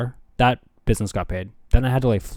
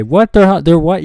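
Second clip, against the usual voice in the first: high, loud, stumbling disbelief; mock shock; 7 semitones higher, 10 dB louder than usual. Friend learns tiny house costs a hundred K, shocked. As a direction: act floored by the price.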